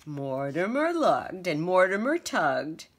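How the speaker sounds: ordinary delivery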